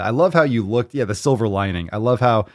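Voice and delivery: ordinary delivery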